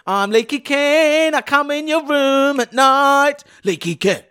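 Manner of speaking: high, slow creepy falsetto; Leaky Ken voice. Pretend. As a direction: croon it like a creepy night visitor. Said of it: "They could do this".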